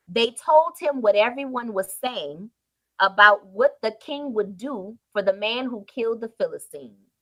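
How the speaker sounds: usual speaking voice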